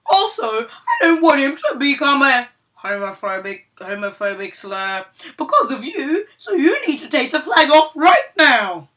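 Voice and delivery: high, loud, shrill-edged demanding mom; loud indignant Karen voice; entitled mom voice. Acted. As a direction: play the outraged entitled customer.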